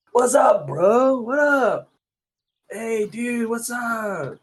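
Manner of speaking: goofy Californian bro voice; Californian dude. Retold or imitated. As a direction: greet a buddy like a Cali bro. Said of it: "California would probably be".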